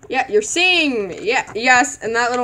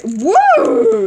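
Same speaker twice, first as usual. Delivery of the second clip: excited whoop; 9 semitones higher, 7 dB louder than usual. Real, not acted. Excited at glittery toy.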